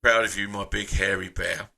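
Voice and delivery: ordinary talk, nothing marked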